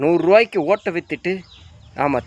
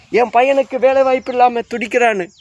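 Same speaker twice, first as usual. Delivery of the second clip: higher mocking whine; mock crying lament; 6 semitones higher than usual. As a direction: mimic a parent crying over a jobless son. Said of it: "அழுவுறீங்களா?". (வேலையில்லா மகனுக்காக அழும் பெற்றோரின் கேலியான உயர்ந்த குரல்)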